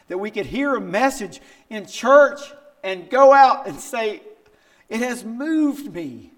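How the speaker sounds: high, loud, soaring preaching cry; fervent appeal. Acted. Preacher urging the church to be moved, soaring high and loud. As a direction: lift it up in passionate longing.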